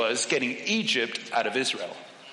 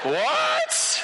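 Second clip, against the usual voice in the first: very high disbelieving squeal; a stunned, strangled what; shocked squeak. Retold or imitated. Diver squeaking in disbelief at the boat boy.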